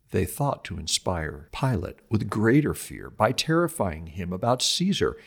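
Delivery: usual speaking voice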